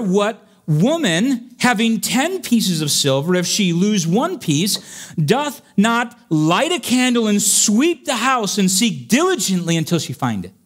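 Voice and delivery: high, ringing pulpit reading; forceful scripture preaching. Acted. Preacher reads the lost coin parable to the congregation, forceful and high.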